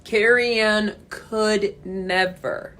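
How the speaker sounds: slow, sassy put-down; sassy dismissal. Real, not acted. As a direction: draw it out with scorn.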